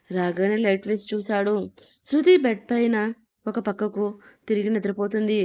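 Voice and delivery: ordinary delivery, nothing marked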